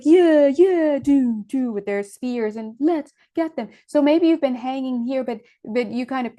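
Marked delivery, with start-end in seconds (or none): 0.0-1.7 loud, higher-pitched mob chant; mock war cry from the crowd; rowdy mob cheer. Mimics a mob with spears. 2.8-3.7 mob rallying. Acted. Mimics the mob.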